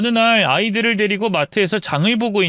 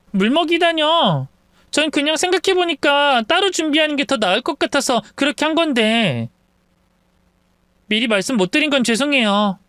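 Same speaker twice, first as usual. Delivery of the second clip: higher-pitched sister-in-law making excuses; defensive sister-in-law; 9 semitones higher than usual. Acted. Sister-in-law excusing herself over the gift, defensive. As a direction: play an innocent-sounding excuse in a raised voice.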